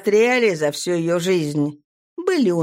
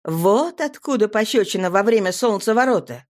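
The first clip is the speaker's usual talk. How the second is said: ordinary delivery, nothing marked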